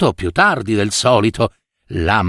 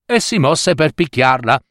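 ordinary delivery

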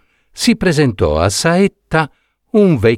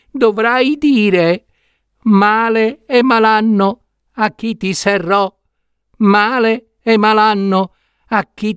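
much higher small voice, urgent instructions; tiny prince giving the spell; 10 semitones higher than usual. Acted. Enchanted prince telling Saetta how to free him, tiny high urgent voice. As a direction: teach the magic words like a tiny trapped prince.

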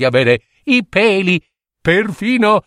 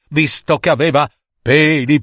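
ordinary delivery, nothing marked

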